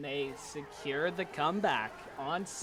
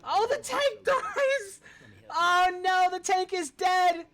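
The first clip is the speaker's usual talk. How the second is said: climactic play-by-play; 14 semitones higher, 9 dB louder than usual. Real, not acted. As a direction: cry out the big moment like a caster. Live play-by-play of game.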